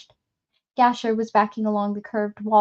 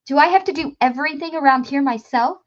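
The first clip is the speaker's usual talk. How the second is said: loud, higher, fed-up yell; exasperated shout; 5 semitones higher, 6 dB louder, faster than usual. A narrator's reading. TikTok fed up with his men, exasperated shout. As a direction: shout it like he's tired of everyone. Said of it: "TikTok shouted, more exasperated than angry".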